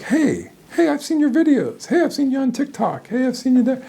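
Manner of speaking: high, loud, eager calls of recognition; excited fan greeting. Retold or imitated. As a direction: greet him like a starstruck fan spotting a celebrity. Fans recognizing him at conferences.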